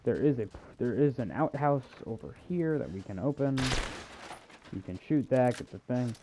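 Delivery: usual speaking voice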